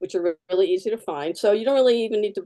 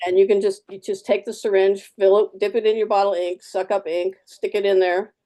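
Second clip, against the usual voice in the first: ordinary delivery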